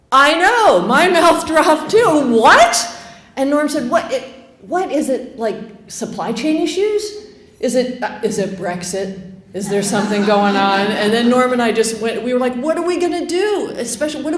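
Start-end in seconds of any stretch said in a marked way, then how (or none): 2.4-2.9 shrieked high and loud in disbelief; a squeal of pure shock; shocked what. Retold or imitated. Tourist reacting to the bartender's news, shrieked disbelief. 3.9-10.9 pepper the bartender with panicked questions; alarmed questions. Retold or imitated.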